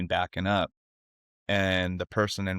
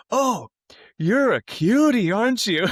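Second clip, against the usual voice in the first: mocking taunt; 13 semitones higher, 6 dB louder than usual. Truck driver to the crying girl, loud mocking coo. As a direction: imitate a bully cooing to belittle someone.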